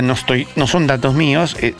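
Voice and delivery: ordinary delivery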